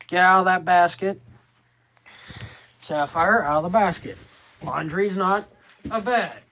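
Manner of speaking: loud, high scolding of a pet; scolding the cat. Real, not acted. Scolding the cat Sapphire off laundry.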